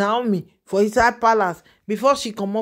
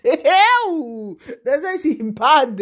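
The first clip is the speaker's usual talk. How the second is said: very slow, laughing through They say she bad; laughing repeat; 3 semitones higher, much slower than usual. Real, not acted. Repeating the rumour to viewers, she laughs it out slowly. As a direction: chuckle through the words as you draw them out.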